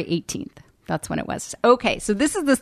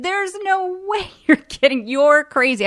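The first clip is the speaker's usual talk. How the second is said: high, laughing you're-kidding; laughing disbelief; 9 semitones higher, 4 dB louder than usual. Retold or imitated. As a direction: scoff and laugh it off. Boyfriend disbelieving her news.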